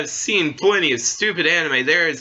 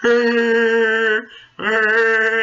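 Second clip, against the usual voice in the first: much higher, louder goofy cartoon grunts; Peter Griffin grunt; 10 semitones higher, 4 dB louder than usual. Mocking Family Guy's plotless episodes, goofy loud grunts. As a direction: bark out silly cartoon-dad noises. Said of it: "Not with Family Guy".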